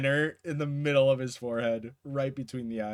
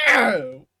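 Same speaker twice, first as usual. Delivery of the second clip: loud acted yelp of pain; 3 semitones higher, 11 dB louder than usual. Boggle jolting up after being smacked, pained yelp. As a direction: yelp as if jolted awake. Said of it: "He's just like".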